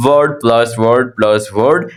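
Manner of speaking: word plus word, each part said slowly; slow spelled-out formula. Shown as a demonstration. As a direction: lay it out step by step. (धीमे-धीमे, हर शब्द अलग)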